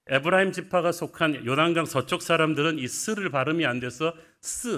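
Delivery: usual speaking voice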